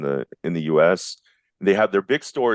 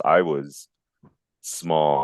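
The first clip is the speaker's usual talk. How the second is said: normal talking voice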